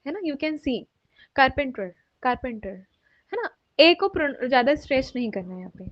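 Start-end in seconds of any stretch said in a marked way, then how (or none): 1.4-2.8 "carpenter" said slowly, the "pe" short and unstretched; correct carpenter. Shown as a demonstration. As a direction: say "carpenter" slow, without stretching the "pe". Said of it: "This is the right pronunciation". ("carpenter" धीरे, "पे" बिना खींचे)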